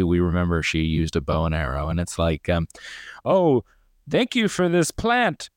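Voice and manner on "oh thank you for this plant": high, slow, syrupy mock thanks; mock gushing. Parodying a character praising the Katniss plant, high and slow. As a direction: ham up the gratitude, much higher and slower.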